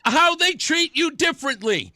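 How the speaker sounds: loud, high, pointed retort; real exasperated shout; heated retort. Real, not acted. Host shouting back at the clip.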